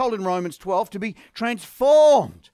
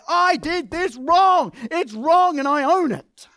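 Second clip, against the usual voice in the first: a higher, louder, slow admission of fault; a heartfelt confession, slow and raised; slow earnest confession; 8 semitones higher, 6 dB louder, slower than usual. Shown as a demonstration. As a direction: lean into every word and own it. Modelled confession, heavy and earnest.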